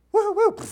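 high, loud playful woo-woo; mock excited woo. Pastor mimicking eager kids rushing out, high excited woo. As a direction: whoop like an eager kid running off.

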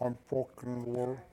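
muffled, as if underwater; talking underwater. Real, not acted. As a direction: voice garbled like underwater. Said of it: "Sounds like you're talking underwater".

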